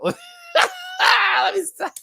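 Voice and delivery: very high, loud, drawn-out laughing squeal; helpless laughter. Real, not acted. Host trying to stop teasing, shrieking with laughter. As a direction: lose it completely mid-phrase. Cracking up again.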